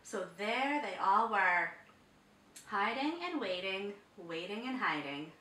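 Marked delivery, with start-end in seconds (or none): none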